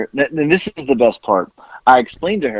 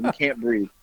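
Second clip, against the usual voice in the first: ordinary talk, nothing marked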